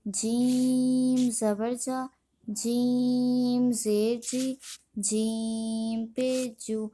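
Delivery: جیم held very long on its vowel, then the rest short; slow spelling drill, long-drawn جیم; elongated madd. Shown as a demonstration. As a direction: stretch the ee in جیم for five counts. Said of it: "مد ہوگا اسے ہم پانچ الف کھینچیں گے".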